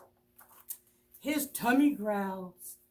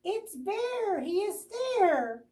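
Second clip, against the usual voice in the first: very high excited cry; squawking birds; 10 semitones higher than usual. Acted. Birds spotting Bear, excited squawk. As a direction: shriek with joy from above. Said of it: "all squawk from the sky".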